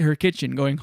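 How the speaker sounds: normal talking voice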